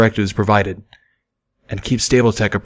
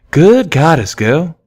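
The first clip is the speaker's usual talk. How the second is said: higher and louder exclamation; cried out; 7 semitones higher, 5 dB louder, much slower than usual. A narrator's reading. Mare at the counter exclaims at Pip, amused cry. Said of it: "cried one of the mares".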